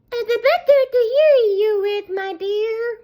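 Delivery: the wolf keeps up the sugary act; sly wolf. A narrator's reading. Wolf answers the girl in a high mock-granny voice. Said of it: "replied the wolf".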